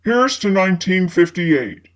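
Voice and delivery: low male character voice; man's voice. A narrator's reading. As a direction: voice Fitz low and smooth. Fitz toasts over the crowd.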